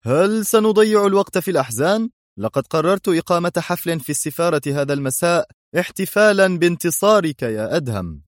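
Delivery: high, put-on merriment; jolly them along with forced gaiety; artificially upbeat ambassador; forced cheer. A narrator's reading. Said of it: "صاح السفير في مرح مفتعل".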